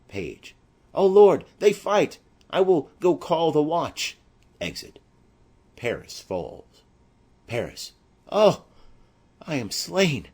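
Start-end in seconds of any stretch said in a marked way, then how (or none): 0.9-4.2 high, loud panicked outcry; a boy terrified, running for help; frightened cry. Acted. Page sees Paris and Romeo fight, panicked high cry. 8.3-10.3 high, loud cry of pain, fading; dying cry. Acted. Paris struck down by Romeo, pained dying cry.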